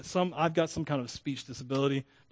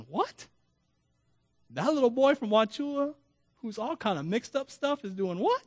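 puzzled onlooker; 7 semitones higher than usual. Retold or imitated.

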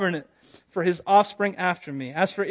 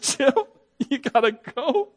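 nervous laughter; 7 semitones higher, slower than usual. Retold or imitated. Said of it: "nervous laughter hit me bad".

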